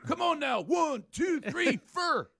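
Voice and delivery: high, slow rocker shout. Retold or imitated. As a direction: count it in like a stadium rocker. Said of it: "He doesn't go".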